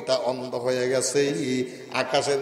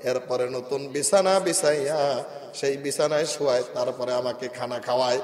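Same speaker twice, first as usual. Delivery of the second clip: ordinary talk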